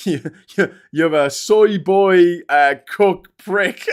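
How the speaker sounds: slow, loud sneering troll voice; mocking troll insult. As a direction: drag out the insult like a smug troll.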